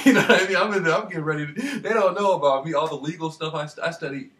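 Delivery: loud, laughing outburst; excited laughing rant. Real, not acted. Bragging to viewers about his legal knowledge, loud and laughing. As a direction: shout it out, laughing and worked up. Cackles, worked up about nurse.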